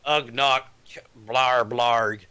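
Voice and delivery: low grunting caveman voice. Retold or imitated. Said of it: "broken caveman English".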